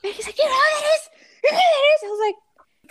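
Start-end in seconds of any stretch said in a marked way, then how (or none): 0.4-2.0 laughing excited shout, much higher and louder; freaking out. Retold or imitated. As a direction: starstruck yell. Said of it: "Like, freaking out".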